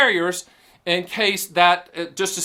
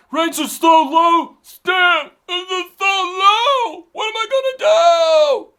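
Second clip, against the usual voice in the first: high, slow, loud whining plea, much higher than his own voice; panicked client; 12 semitones higher, 6 dB louder, much slower than usual. Retold or imitated. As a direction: ham up a frantic saver begging for help. Mock panicked client complaining to Stan.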